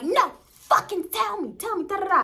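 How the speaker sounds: reenacted screaming demand; retold yelling. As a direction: yell it at him. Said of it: "I'm going off on him".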